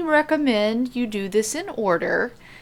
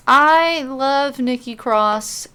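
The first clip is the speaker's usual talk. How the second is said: usual speaking voice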